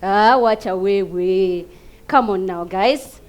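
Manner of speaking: ordinary talk, nothing marked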